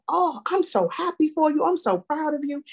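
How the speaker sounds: high, delighted mock praise; overjoyed. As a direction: gush with pride at her news. Proud of the girl's new job.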